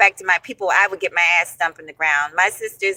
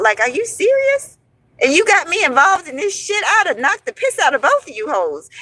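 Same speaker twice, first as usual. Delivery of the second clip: indignant disbelief; 8 semitones higher than usual. Real, not acted. Angry disbelief at the friends.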